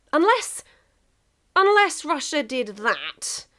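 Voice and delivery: sudden high yelp then slow dread; alarmed exclamation. A narrator's reading. Narrator voicing England's panic at Russia's move, alarmed. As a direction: catch the danger mid-word.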